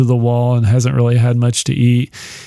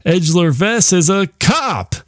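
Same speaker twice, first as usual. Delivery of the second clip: dawning horror; 4 semitones higher, much slower than usual. Retold or imitated. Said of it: "And she's like".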